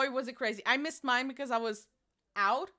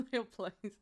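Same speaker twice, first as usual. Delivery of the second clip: ordinary talk, nothing marked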